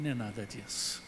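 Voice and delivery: very soft, low inner voice; inner confession. Retold or imitated. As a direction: murmur it as a private realisation.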